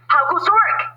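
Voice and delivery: high, loud farmer call. Acted. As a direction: bellow it as the lazy farmer. Farmer calls from bed.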